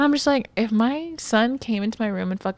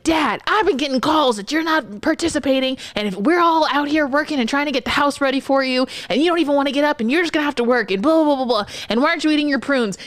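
fast, high, nagging rant; scolding son; 6 semitones higher than usual. Retold or imitated. Son barges in scolding dad.

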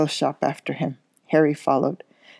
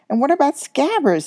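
indignant; 10 semitones higher than usual. A narrator's reading. Ron protests.

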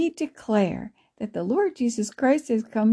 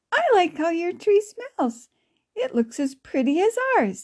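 put-on higher-pitched child's compliment; admiring girl; 7 semitones higher than usual. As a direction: lift the voice like an admiring little girl. Child character compliments the tree.

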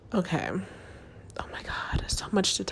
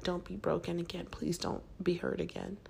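soft, hushed imagined plea; pleading with her heart; 9 dB quieter than usual. Retold or imitated. Voicing a contestant talking to her heart, softly pleading. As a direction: plead quietly with your own heart. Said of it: "She's telling her heart".

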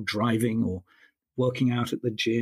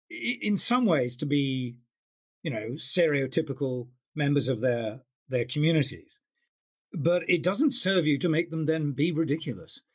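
usual speaking voice

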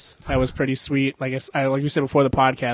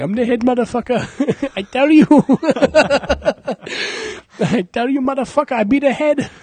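much higher, laughing through a hammed-up accent; mock Indian accent; 10 semitones higher, 4 dB louder than usual. Comedian voices Lord Ganesha in a high mock accent. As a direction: squeak it out like a cartoon Ganesha. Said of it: "He's like".

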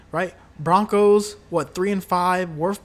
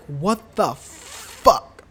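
exasperated what the; much slower than usual. Retold or imitated. Mock reaction to a bad fumble.

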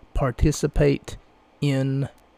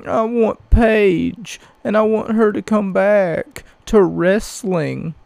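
high, whiny mock-pleading fan; hammed-up whimpering complaint; fake crying; 6 semitones higher, 4 dB louder than usual. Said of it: "they're the same people who said".

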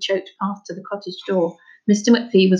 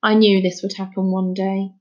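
usual speaking voice

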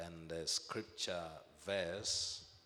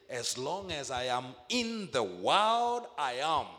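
louder, higher proclamation; preaching at full force; 5 semitones higher, 7 dB louder than usual. Acted. Preacher declaring Jesus' words to the church, loud. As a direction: proclaim it to the back pew.

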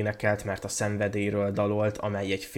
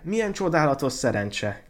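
high, loud cry of wonder; delighted exclamation; 6 semitones higher, 5 dB louder than usual. A narrator's reading. Student spots the red rose, exclaiming. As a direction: exclaim at sudden good luck. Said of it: "kiáltott fel".

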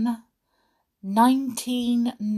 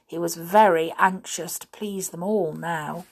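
plain reading voice

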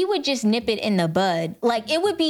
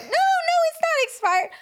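indignant denial. Retold or imitated. To the cop about her registration, a high indignant protest. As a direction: insist it can't be expired. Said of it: "I was like".

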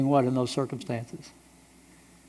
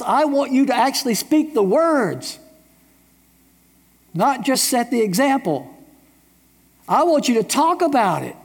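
raised, urgent voice of God; God's urging; 8 semitones higher than usual. Retold or imitated. Preacher voicing God urging the church to speak. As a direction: press the call to speak out like God himself. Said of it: "he says".

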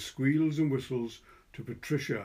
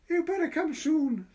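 put-on high, eager child's voice; boy's voice; 4 dB louder than usual. A narrator's reading. Narrator voicing the boy calling to Patricia, high and eager. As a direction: pitch right up like a small boy. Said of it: "the boy said".

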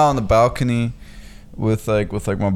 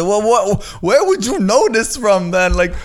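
high, drawn-out, exasperated demand; incredulous challenge; 10 semitones higher, 3 dB louder than usual. Retold or imitated. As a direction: act out a heated retort to an absurd claim. Retold argument, incredulous challenge.

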